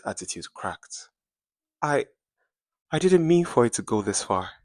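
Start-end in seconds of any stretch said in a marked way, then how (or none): 1.8-4.5 breaking up, higher and louder, tripping on the start; stammering. A narrator's reading. Bella caught out by her friend, stammering a confession. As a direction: stumble over the first words, caught out. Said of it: "she stammered".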